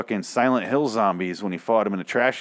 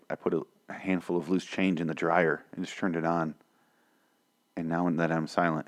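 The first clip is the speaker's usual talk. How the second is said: usual speaking voice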